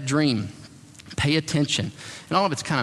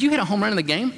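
mock surprise; 8 semitones higher, faster than usual. Girlfriend voiced in surprise.

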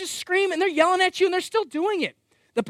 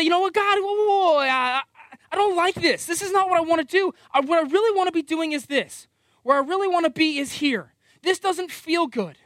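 raised, louder whiny protest; grumpy complaining to God; 6 semitones higher, 4 dB louder than usual. Retold or imitated. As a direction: complain like a rebellious child arguing with God. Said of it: "to kinda get grumpy, to get almost rebellious".

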